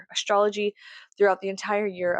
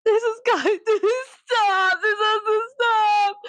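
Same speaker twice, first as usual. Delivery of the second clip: high, drawn-out fake sobbing; hammed-up tearful whine; mock wailing; 13 semitones higher, 4 dB louder, slower than usual. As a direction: wail it slowly in comic despair. Repeats the plea as comic wailing.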